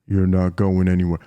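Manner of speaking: very deep devil voice; Slenderman. Retold or imitated. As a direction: drop as deep as you possibly can. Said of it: "It sounded like the devil was speaking".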